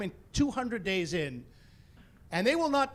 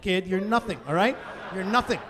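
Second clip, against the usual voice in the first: normal talking voice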